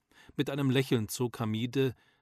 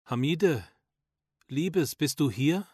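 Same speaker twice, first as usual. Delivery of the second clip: higher, slightly louder call, a touch of flutter; understated fluttery voice of a father calling; 3 semitones higher, 5 dB louder, slower than usual. A narrator's reading. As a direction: call out gently for someone, slowly. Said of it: "rief Abid mit flatteriger Stimme".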